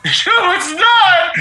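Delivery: high, cracked, laughing mock wail; squeaky high mock sob line. Imitating the sobbing stranger in a high squeaky wail. Mock reply of crying lady.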